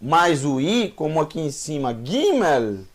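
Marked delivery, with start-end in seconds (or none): 2.0-2.8 Gimel dito com G duro de gato. Shown as a demonstration. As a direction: diga Gimel com o G de gato, não de gente. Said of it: "não é Gimel". (Gimel com G duro, como em gato)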